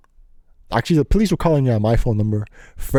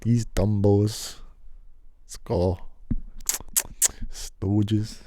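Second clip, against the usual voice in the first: unimpressed big brother; 5 dB quieter, much slower than usual. Retold or imitated. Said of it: "big bro baby's like".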